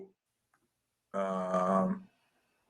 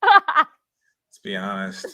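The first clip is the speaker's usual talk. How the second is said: laughing; 5 dB louder, much slower than usual. Real, not acted. Laughing verdict on the story.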